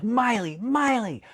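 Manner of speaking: high, drawn-out name call; calling the dog. Retold or imitated. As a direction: sing out the name to rouse a dog. Calling dog while clapping.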